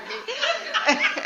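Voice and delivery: high, breathy laughter. Real, not acted. Laughing after the slimy rock story. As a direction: give in to the laugh.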